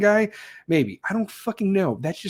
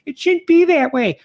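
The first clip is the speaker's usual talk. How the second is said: much higher, louder mocking complaint; mock feminist protest; 12 semitones higher, 7 dB louder than usual. Mocking the feminists out there in a high loud whine. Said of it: "I understand you feminists out there like".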